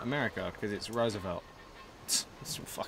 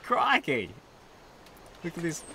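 a quick high mock Australian crikey; Australian accent; 5 semitones higher than usual. Gamer jokes about playing in an Australian accent. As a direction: toss out a jokey Australian exclamation. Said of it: "with the fucking Australian accent".

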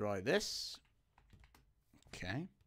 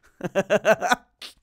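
loud laugh; 10 semitones higher, 14 dB louder than usual. Real, not acted. Laughing at phone call.

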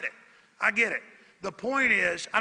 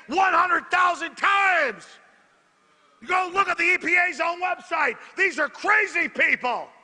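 shouting, pitch climbing higher; paranoid late night rant; 6 semitones higher than usual. Real, not acted. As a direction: yell it like a furious broadcaster. Ranting shouts at globalists.

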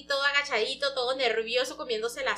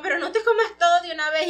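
higher, louder chiding; mock scolding; 5 semitones higher, 6 dB louder than usual. Bao chides Ran for gobbling food, mock scolding. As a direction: scold lightly, voice jumping up.

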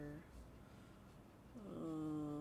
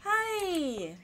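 loud, high happy hello; excited greeting; 13 semitones higher, 17 dB louder than usual. Real, not acted. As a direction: burst out delighted.

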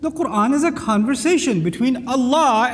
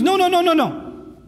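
rapid string of higher-pitched noes; emphatic no; 9 semitones higher than usual. Shown as a demonstration. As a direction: shut it down with repeated noes. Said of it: "means no, not at all".

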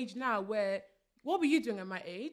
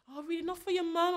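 higher, wounded mum whine; guilt-tripping mum; 6 semitones higher than usual. Retold or imitated. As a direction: make her feel guilty for saying no. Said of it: "It would be always like".

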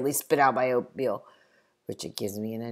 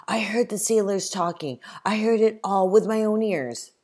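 higher, louder indignant insisting; raised, offended protest; insistent protest; 9 semitones higher, 7 dB louder than usual. Acted. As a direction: defend yourself, stung. Richard glares, insists angrily.

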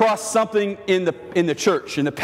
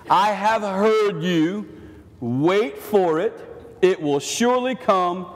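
slow reassuring voice; slower than usual. Acted. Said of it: "God says to Habakkuk".

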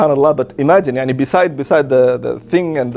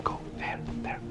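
very soft, voiceless whisper; 21 dB quieter than usual. Retold or imitated. Sheikh secretly sending the visitor into the room, whispering. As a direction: whisper a covert direction.

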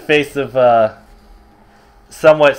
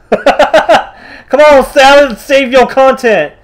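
high, slow pleading voice; high mock plea; 8 semitones higher, much slower than usual. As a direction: beg in a squeaky high voice. High pleading to character Salad.

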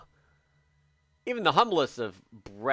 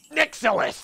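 excited shout-out; 8 dB louder than usual. Real, not acted. Thanking donor excitedly.

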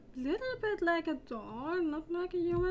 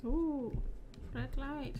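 ordinary delivery

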